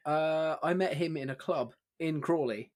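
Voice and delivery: ordinary talk, nothing marked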